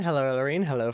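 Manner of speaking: ordinary talk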